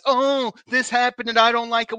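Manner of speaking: mocking whiny fan. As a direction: sneer at the online complainers. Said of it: "keyboard warrior".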